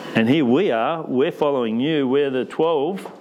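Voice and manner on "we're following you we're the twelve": ordinary talk, nothing marked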